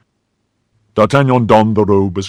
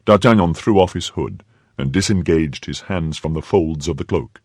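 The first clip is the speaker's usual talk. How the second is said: ordinary delivery, nothing marked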